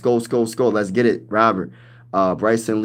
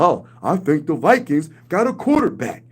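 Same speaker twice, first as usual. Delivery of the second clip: lightly voiced pundit, a touch higher; mock TV pundit verdict; Stephen A. Smith impression; 4 semitones higher than usual. Streamer imitating a sports pundit's grudging praise. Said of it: "Stephen A. Smith is gonna be like".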